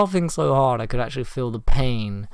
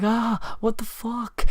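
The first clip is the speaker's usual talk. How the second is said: high-pitched retold panic; frightened mock cry; 10 semitones higher, slower than usual. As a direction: imitate a friend's high cry of alarm. Acting out friend's fright at moth.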